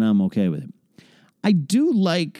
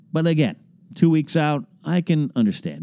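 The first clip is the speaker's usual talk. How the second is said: usual speaking voice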